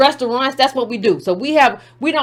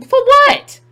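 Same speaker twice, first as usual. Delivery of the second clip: high, loud "for what?"; incredulous outburst; 12 semitones higher, 6 dB louder than usual. Real, not acted. Mocking the inspiration claim, high incredulous yelp. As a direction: throw it back in disbelief.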